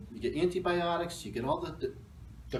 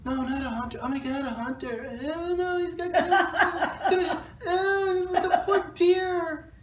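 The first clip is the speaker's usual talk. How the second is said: mock horrified locals; 14 semitones higher, 5 dB louder than usual. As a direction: wail like squeamish anti-hunting locals, half laughing. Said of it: "here in Connecticut, they'll be like".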